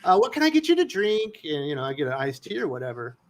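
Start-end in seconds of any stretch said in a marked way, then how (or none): 0.0-1.4 much higher, sing-song camp waiter voice; mock flamboyant waiter. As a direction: ham up a flamboyant waiter taking orders.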